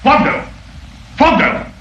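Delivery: high-pitched urgent summons; excited, breathless call. Acted. Father running downstairs to call for help, excited and breathless. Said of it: "excited and breathless".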